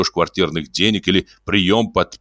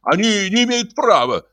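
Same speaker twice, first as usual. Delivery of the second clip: high protest; 14 semitones higher than usual. Acted. Iznurenkov protests to Ostap.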